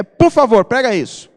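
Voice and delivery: please that would be better left unsaid; rude please. Shown as a demonstration. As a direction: say please as a demand. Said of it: "o tom de voz muda tudo".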